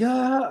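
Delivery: high panicked ah; mock scared high ah; panicked yelp. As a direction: yelp like a scared young driver. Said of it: "he starts freaking out".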